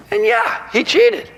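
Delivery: high incredulous yes he cheated; emphatic high yeah. Real, not acted. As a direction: voice jumps up on yeah. Emphatic admission.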